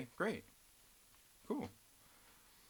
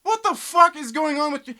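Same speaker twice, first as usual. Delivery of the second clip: exasperated rant; 6 semitones higher, 6 dB louder than usual. Real, not acted. Ranting at chat.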